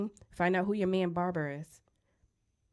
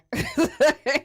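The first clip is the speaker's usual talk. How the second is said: loud laugh; burst of laughter; 9 semitones higher, 8 dB louder than usual. Real, not acted. Laughs at own joke.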